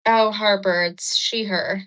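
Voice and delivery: ordinary talk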